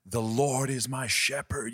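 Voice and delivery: slow, high, booming pulpit voice; showy preacher read. Retold or imitated. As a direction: proclaim it like a flashy young preacher. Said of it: "I don't know how loud this is gonna sound".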